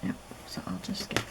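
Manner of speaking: breathy whispered aside; whispering. Real, not acted. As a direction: whisper it to yourself. Whispered aside while sorting.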